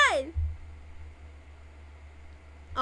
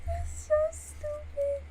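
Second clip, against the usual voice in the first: talking through real giggles; laughing helplessly at the message; 7 semitones higher than usual. Real, not acted. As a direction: let the laugh spill into every word. Laughing, then repeated in a whisper.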